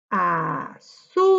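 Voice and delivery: load the voice onto the ZÚ of "Azúcar"; stressed syllable stretched. Shown as a demonstration. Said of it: "elevamos un poquito la voz".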